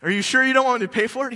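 high, loud, fast nervous offer; eager offer. Retold or imitated. Archaeologist nervously offering to pay the seller, high and fast. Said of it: "he's like".

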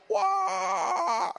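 high, loud cry of wonder; astonished whoa. Retold or imitated. Moses meeting the burning bush, shouted awe. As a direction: gasp it out in awe. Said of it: "he's like".

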